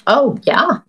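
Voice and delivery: ordinary delivery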